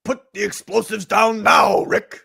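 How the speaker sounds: loud commanding shout. Acted. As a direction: yell the order at him.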